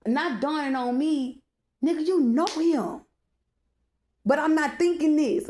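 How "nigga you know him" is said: higher, louder accusation; accusing realization. Retold or imitated. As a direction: call him out, he knows the guy. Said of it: "And I'm like".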